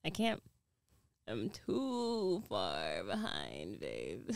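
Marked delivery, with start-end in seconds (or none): 1.3-4.3 mock whine. Mock whining about being behind.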